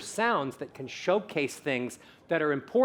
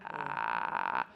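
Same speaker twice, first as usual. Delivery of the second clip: very low creaky "ah"; squeaky-hinge vocal fry; 7 dB quieter than usual. Shown as a demonstration. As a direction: creak it like a rusty door. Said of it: "I call it a squeaky hinge".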